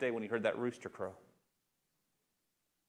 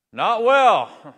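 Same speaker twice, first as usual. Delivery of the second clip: loud, high call back from the boat; shouted reply; 10 semitones higher, 17 dB louder than usual. Acted. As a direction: yell it back across the water, glum.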